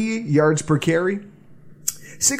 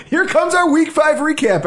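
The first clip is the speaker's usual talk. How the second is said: excited; 10 semitones higher, 4 dB louder than usual. Real, not acted. Hyping the show segment.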